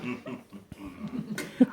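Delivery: a sly throaty chuckle; throaty laugh. Acted. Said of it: "The Grinch laughed in his throat".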